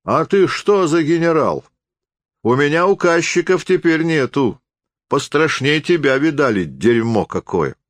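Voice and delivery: high, scornful rebuke; voiced coarse contempt, pitched high; angry retort. A narrator's reading. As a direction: put the upstart in his place. Rude retort in a quarrel.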